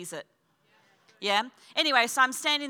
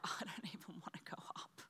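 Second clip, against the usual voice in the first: near-whispered private grumble; hushed inner complaint, barely voiced; whispered reluctance; 4 semitones lower, 21 dB quieter than usual. Retold reluctant inner thought.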